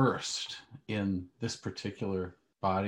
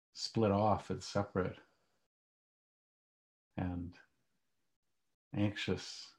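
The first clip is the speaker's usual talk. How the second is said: calm grounding exercise; 4 dB quieter than usual. Acted. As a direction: guide them gently into stillness. Leads a guided meditation.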